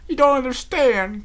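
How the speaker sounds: high, loud, slow anguish; tearful cry. A narrator's reading. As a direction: plead through tears. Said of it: "cried Applejack".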